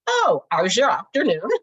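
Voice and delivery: loud, hammed-up British greeting; British accent. Shown as a demonstration. Crafter parodies quick Azure Afternoon for viewers, mock British accent. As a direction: put on a terrible British accent, loudly. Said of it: "It sounds like a British accent".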